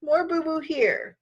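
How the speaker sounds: put-on high, slow little kid showing hurts; lightly voiced toddler. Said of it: "Fudge said".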